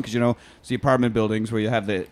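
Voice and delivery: ordinary talk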